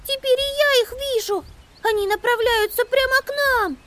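put-on very high, alarmed girl mouse cry; shouting. A narrator's reading. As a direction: call out in alarm. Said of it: "крикнула Амелия".

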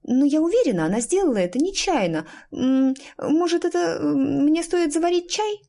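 put-on high-pitched, hesitant, placating girl; smooth it over timidly, voice climbing high; anxious peacemaker. A narrator's reading. Petulia nervously excuses a mishap. (робкая, примирительная девочка)

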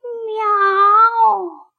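high, long meow; drawn-out cat meow. A narrator's reading. Unseen someone by the porch teases the puppy, high meow. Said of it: "кто-то сказал".

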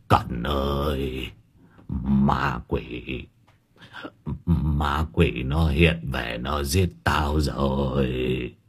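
low, drawn-out, weak moan; feeble whimpering. A narrator's reading. Terrified master tells Cẩn of ghosts, feeble and wailing. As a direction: drag it out softly as if the strength is gone. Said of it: "Lão thều thào".

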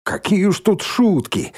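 high, lilting mockery; teasing, lilting lightness; singsong taunt. A narrator's reading. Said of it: "пропела Шмакова".

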